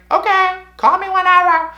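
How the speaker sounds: very high, sing-out mom voice; high-pitched mock mom; mom impression. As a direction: chirp it loudly as a carefree mom. Acting out mom's breezy reply.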